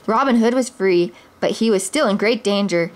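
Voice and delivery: ordinary delivery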